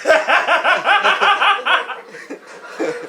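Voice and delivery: high, loud howling cackle; loud cackling laugh. Real, not acted. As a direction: let out a huge howl of laughter. Big laugh mid rant.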